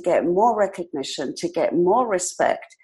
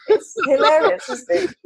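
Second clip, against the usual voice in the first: ordinary talk, nothing marked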